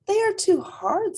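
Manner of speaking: put-on a small child complaining about her tooth; little rabbit voice.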